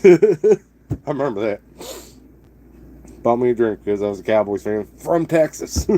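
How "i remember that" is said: laughing fondly. Real, not acted. Fond memory of the bar.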